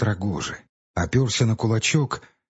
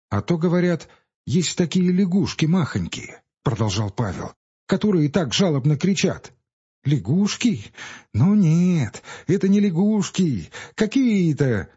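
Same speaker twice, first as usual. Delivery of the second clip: lightly voiced village boy; a touch of an eager boy; boy's voice; 7 semitones higher than usual. A narrator's reading. Pavel and boys argue.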